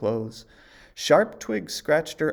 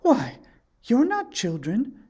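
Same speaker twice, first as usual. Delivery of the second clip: much higher, startled realisation; 12 semitones higher, 6 dB louder than usual. A narrator's reading. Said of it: "she said".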